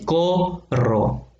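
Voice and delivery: "corro" broken into a long "co" and a separate "rro"; stressed-syllable demo. Shown as a demonstration. Teacher showing the two O vowels, "corro" split slowly. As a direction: stretch both o sounds in "corro", syllable by syllable. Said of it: "la O y la O".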